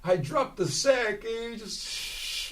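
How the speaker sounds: high, slow, soft little voice; high soft fading girlfriend voice; meek girlfriend. Retold or imitated. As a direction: play the timid girlfriend excusing him.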